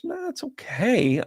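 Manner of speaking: high, slow, noncommittal mimicry; imitated lukewarm shrug. Client asked if she loves it, imitated lukewarm. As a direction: drag out a high-pitched so-so.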